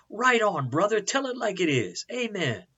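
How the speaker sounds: high-pitched cheering church lady; mock scream. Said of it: "they jumped to their feet and screamed".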